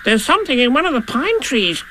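very high, startled little squeak; small, jumpy creature voice; sudden Piglet. Acted. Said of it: "said Piglet suddenly".

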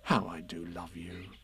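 very soft, low and loving; a hushed, fond confession; tender. Acted. Christopher Robin to Pooh, tender and hushed.

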